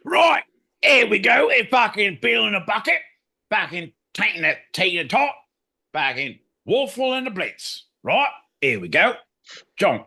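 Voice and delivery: over-the-top Cockney shout; Cockney character voice. Acted. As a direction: ham up a brash London geezer, way up high. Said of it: "Decipher That Cockney Slang".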